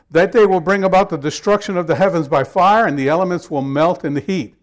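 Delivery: loud, declaiming Bible reading; scripture reading. Acted. Pastor reading Second Peter to his congregation, loud proclamation.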